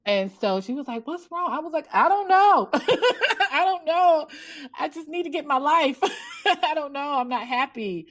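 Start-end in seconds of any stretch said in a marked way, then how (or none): 1.1-1.5 lightly voiced facilitator, higher pitch; concerned facilitator. Facilitator asking a crying participant, lightly imitated concern. 1.9-8.1 high, hammed-up wail breaking into laughter; mock bawling. Author at the retreat in front of the room, mock bawling. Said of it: "just start crying. I'm just bawling".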